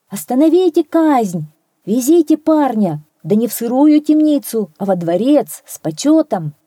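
high, loud messenger's cry; calling from afar. A narrator's reading. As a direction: call out urgent orders, easing toward the end. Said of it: "Издали машет белым платком и кричит". (громкий крик гонца)

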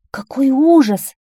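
shocked, high and loud exclamation; exclaiming. A narrator's reading. Townspeople startled by the whiners' fate, exclaiming. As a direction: cry out slowly in horror. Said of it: "воскликнули они".

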